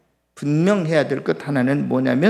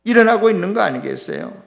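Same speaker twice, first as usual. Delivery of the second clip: ordinary talk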